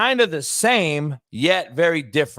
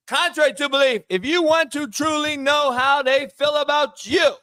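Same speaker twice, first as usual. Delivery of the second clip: hyped shout; 11 semitones higher than usual. Acted. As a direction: shout the quote like a hype man. Hyped quote delivery.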